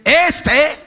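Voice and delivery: high, loud ringing announcement; sudden loud, high declaration; heavenly proclamation. Acted.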